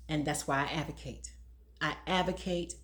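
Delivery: usual speaking voice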